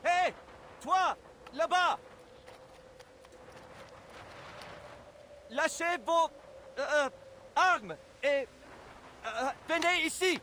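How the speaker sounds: very high, strained hailing shout in French; calling out afar. Acted. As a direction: holler haltingly across no man's land. Calling across no man's land.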